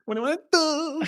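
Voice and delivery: high hammed-up come-on voice; quoting the line. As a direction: squeal the cheesy pickup line. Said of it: "my favorite".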